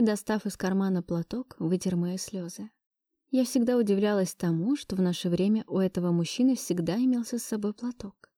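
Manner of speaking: plain reading voice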